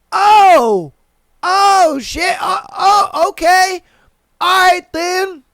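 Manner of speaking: high, loud startled "oh"; startled realization. Retold or imitated. Retelling his reaction when she moved his hand, startled. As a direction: caught off guard and rolling with it.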